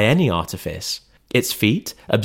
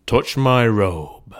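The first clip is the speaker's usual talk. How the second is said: echoing ethereal energy being; slower than usual. Acted. Ghost commands.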